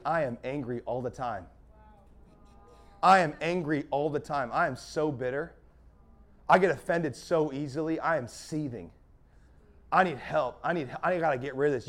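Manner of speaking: low, soft admission of a struggle; acted confession. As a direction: confess it quietly, voice dropped. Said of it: "You gotta tell somebody".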